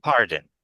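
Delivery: "Pardon" dropping low at the end; falling intonation. Shown as a demonstration. Teacher repeats the incorrect example for students, "Pardon" with falling pitch. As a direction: say "Pardon" downward, as a statement. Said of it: "that's falling when you say it like that".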